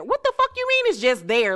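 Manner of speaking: what the fuck, said high and stunned; outraged disbelief. Real, not acted. Vlogger reacting to the wife's answer, high outraged disbelief. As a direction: pitch jumps way up in disbelief.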